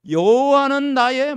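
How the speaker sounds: slow, loud, measured recitation; solemn scripture reading. Acted. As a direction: read the psalm slowly and gravely. Reads psalm aloud. (천천히 장엄한 성경 낭독)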